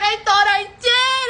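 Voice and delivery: high mock protest; defensive man imitated. Man insisting he locked the door, high mock protest. Resumes his excuse, now louder.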